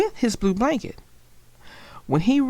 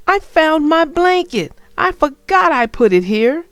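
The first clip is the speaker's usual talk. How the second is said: put-on high and loud child voice; excited young Franklin; 6 semitones higher, 7 dB louder than usual. Franklin telling his parents about the blanket, high and loud. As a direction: bubble over with the news.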